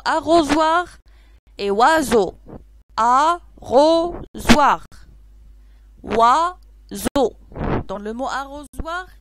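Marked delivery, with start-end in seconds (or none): 3.0-4.9 ARROSOIR loud and very slow, a, ro, zoir; three separate loud beats on arrosoir; syllable by syllable. Shown as a demonstration. Teacher shows pupils arrosoir in three slow, separated syllables. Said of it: "il y a trois syllabes". 6.0-7.4 OISEAU in two loud, separate beats, oi, zeau; syllable by syllable. Shown as a demonstration. Teacher shows pupils oiseau in two separated syllables. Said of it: "il y a deux syllabes".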